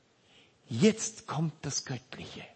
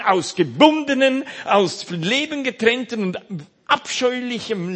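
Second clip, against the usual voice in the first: full-force preaching; 9 semitones higher, 11 dB louder than usual. Acted. Preacher proclaiming what Jesus can do, fervent preaching. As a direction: hammer each phrase home.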